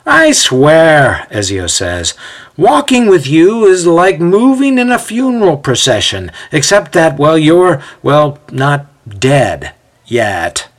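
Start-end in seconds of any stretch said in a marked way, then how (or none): 0.1-1.3 exasperated. A narrator's reading. Impatient man complains to lagging friend. 2.6-10.7 exasperated mocking. A narrator's reading. Impatient man mocks slow friend.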